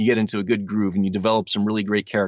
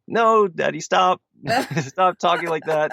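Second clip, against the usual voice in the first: put-on high, pleading kid voice; protesting kids; 8 semitones higher than usual. Dad mimics his kids objecting to his voices, high childlike protest. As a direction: imitate children begging Dad to stop, pitched up. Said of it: "sometimes they're like".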